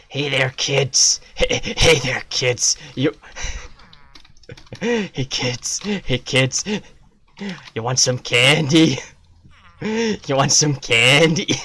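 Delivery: a mock creep offering candy; creepy stranger. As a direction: lure the kids in, hammed up.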